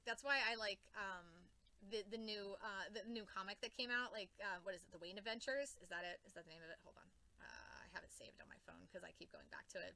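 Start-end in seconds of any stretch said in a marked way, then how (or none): 6.2-9.9 whispered aside to herself. Real, not acted. Vlogger hunting through her phone, whispering. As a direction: breathe it out under her breath.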